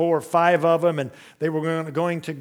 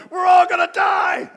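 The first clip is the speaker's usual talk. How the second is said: put-on child's panicked yell; 14 semitones higher, 8 dB louder than usual. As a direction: scream it through the house like a scared child. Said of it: "I remember her yelling that".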